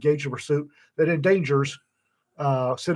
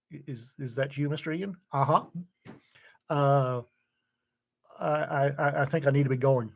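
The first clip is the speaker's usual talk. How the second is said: caught out, meek; 6 dB quieter than usual. Retold or imitated. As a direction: voice drops to a sheepish near-mumble. Bubba caught out by sheriff.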